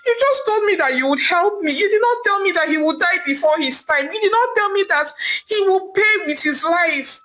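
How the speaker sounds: high, fast, rising scream of accusation. Acted. Adaeze screams at the native doctor who failed her. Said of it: "Adaeze screamed".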